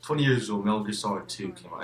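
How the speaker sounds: usual speaking voice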